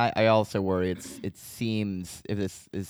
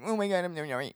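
high, mumbled mock-weepy apology; mock blubbering; 10 semitones higher, 4 dB quieter than usual. Blubbering guy apologizing to her, mock weepy. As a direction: blubber it, pitched way up and indistinct. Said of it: "it's just this blubbering guy".

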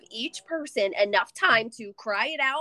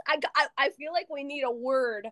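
ordinary talk, nothing marked

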